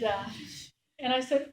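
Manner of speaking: ordinary delivery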